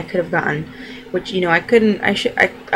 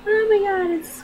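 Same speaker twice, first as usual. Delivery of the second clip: sudden much higher exclamation; amazed. Real, not acted. Telling viewers the weeks fly by, amazed high exclamation. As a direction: gasp it out in real astonishment. Realising how close the due date is.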